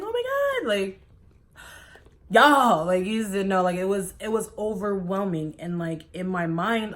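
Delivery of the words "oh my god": high-pitched excited squeal; flipping out. Retold or imitated. Retelling how she couldn't stop exclaiming, high squeal. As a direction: imitate yourself squealing in disbelief. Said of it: "I was just flipping out".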